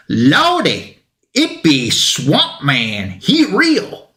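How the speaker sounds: drawn-out, hammed-up citizen voice leaping high; awestruck townsperson. Citizen spots Swamp Thing in the town square, hammed-up astonishment. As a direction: stretch it out slowly, ending in a high mock-amazed squeal. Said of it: "one of the citizens, I love the citizen".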